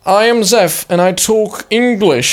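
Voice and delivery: lightly put-on old English gent; old-timey Englishman voice. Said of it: "old-timey Englishman voice".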